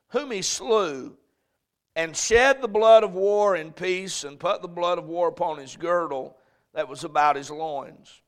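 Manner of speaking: solemn Bible reading; scripture reading. Acted. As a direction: read the verses with weight. Reads scripture aloud.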